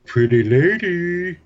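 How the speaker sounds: high, drawn-out mumbled call; boss imitation. Prank-calling the secretary as the boss, slow mumbled impersonation. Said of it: "do a pretty good imitation of him".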